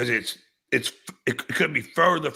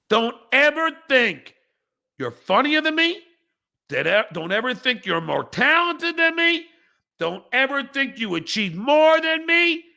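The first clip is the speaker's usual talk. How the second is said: angry; 7 semitones higher than usual. Real, not acted. Furious rant at a viewer.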